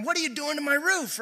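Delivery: indignant high outburst, imitating; mock outrage over the roof, pitched high; furious Peter. Peter furious at men digging through his roof, mock indignant high cry. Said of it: "absolutely furious here and going".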